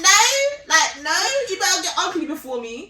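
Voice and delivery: loud, high, laughing demand; playful loud divine demand; God's command. Retold or imitated. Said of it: "I'm like".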